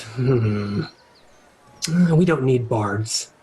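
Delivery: a sly fiend sizing up the hero; devil dealer. Acted. GM voicing the deal-making devil.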